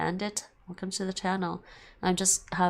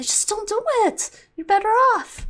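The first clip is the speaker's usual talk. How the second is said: emphatic high advice; 14 semitones higher, 7 dB louder than usual. Real, not acted.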